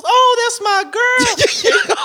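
very high, slow, crying-out oh; imitating the lady claiming her girl; high drawn-out coo. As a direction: stretch it high and sweet. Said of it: "And she goes".

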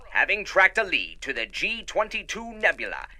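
ordinary talk

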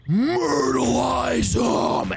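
long, high drawn-out war cry; aunt's battle cry. Acted. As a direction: bellow it like a clan aunt rallying warriors. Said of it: "your Aunt Dory go".

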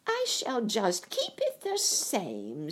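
high, slow, taunting witch; witch mocking. A narrator's reading. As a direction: gloat over the girl. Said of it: "laughing at her".

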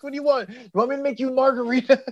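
high, mock-exasperated questions; comically fed up with a dog; exasperated Tom Hanks. Owner asks barking dog its wishes, exasperated imitation. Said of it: "It's like".

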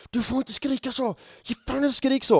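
high-pitched scolding partner voice; imitating his partner. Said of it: "Och hon bara".

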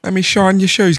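high-pitched Cockney shoeshine patter; mock Cockney accent. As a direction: play a hammy Cockney shoeshiner. Joking London shoeshine character.

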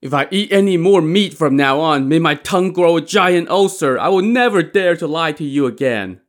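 high, loud, fervent oath; desperate vow. A narrator's reading. Li Kui swears off meat to Daizong, fervently pleading.

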